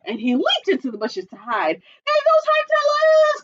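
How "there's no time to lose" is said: high urgent cry. Acted. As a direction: cry it out in a hurry. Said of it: "cried Chicken Little".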